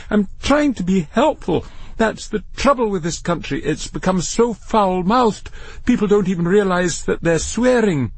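high-pitched, affronted lecturing; prim scolding voice behind a door. Acted. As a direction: lecture with wounded indignation. Disembodied voice lectures Matthew.

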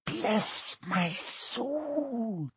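put-on very slow, soft, teary old man; awed whisper. Bartender recognising Harry, tearful whisper. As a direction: breathe it out in wonder. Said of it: "whispered the old bartender".

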